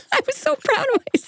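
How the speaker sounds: high, fast, laughing wail; real giggly mock-hurt squeal; laughing protest. Real, not acted. Laughs at own failed accent.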